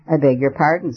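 plain reading voice

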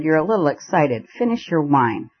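ordinary delivery, nothing marked

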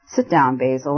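ordinary delivery, nothing marked

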